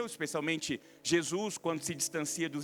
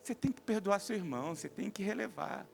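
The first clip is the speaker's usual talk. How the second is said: tender mother; 3 semitones lower, 7 dB quieter than usual. Acted. Mother comforts daughter at bedtime.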